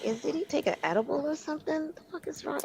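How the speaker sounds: high, hushed disbelief; incredulous. Retold or imitated. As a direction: ask it in stunned disbelief. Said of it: "I was like".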